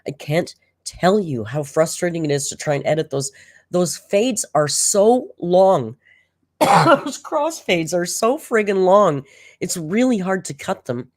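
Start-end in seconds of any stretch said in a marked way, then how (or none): 3.7-9.2 slow, loud drawn-out complaint; sighing about how long the fades drag on; exasperated drawl. Real, not acted. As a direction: stretch the words out in exasperation. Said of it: "complaining about long fades".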